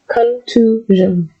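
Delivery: "Contusion." drawn out very slowly, con-TOO-zhun; slow pronunciation. Shown as a demonstration. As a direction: stretch each part of "Contusion.". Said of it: "You can break that down into contusion".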